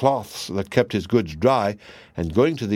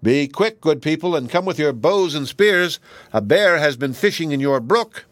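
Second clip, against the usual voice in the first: raised, higher call to the crowd; calling out loudly; 6 semitones higher, 3 dB louder than usual. A narrator's reading. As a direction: call out loud for help. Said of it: "he called out loudly".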